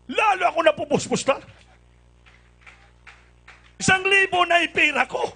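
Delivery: loud and high with joy; overjoyed. Real, not acted. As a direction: swell up with happiness.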